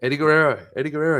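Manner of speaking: hammed-up shout of Eddie Guerrero; calling out Eddie Guerrero. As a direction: call out like a hawker at a door. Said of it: "I keep saying".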